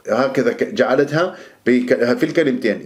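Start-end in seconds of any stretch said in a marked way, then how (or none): none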